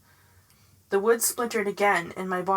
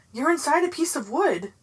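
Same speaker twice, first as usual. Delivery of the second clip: frantic warning; 6 semitones higher, 3 dB louder than usual. A narrator's reading. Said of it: "a voice screamed in my head".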